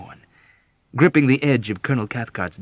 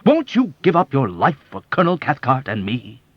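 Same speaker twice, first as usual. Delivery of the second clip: much higher, louder mock of Cathcart's harshness; mock harsh self-righteous; 6 semitones higher, 7 dB louder than usual. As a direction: parody the blustering colonel. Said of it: "emulating Colonel Cathcart's harsh, self-righteous tone".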